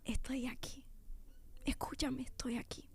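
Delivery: God calling her in a whisper. Retold or imitated. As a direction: breathe it like a gentle call from within.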